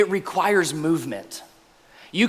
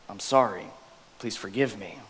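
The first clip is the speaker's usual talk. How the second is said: soft humble apology; 8 semitones lower, 8 dB quieter than usual. Shown as a demonstration.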